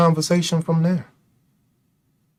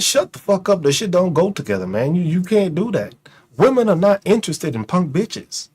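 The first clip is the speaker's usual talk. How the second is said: ordinary delivery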